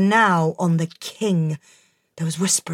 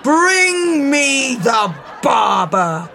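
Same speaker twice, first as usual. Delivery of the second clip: booming warrior king; 4 semitones higher, slower than usual. Acted. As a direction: bellow the order across the hall. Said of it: "bellowed the king".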